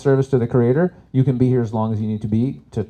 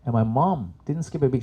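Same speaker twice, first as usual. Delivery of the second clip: ordinary talk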